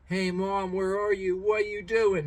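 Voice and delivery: high-pitched pleading pup voice; abandoned dog. Acted. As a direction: imitate a left-behind dog calling for Mom. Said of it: "The dog's probably crying".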